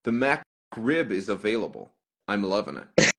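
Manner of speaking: put-on American accent, pitched a bit low. Shown as a demonstration. Irish streamer performs a viewer's requested line, in an American accent. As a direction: do your best American accent. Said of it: "do my American accent".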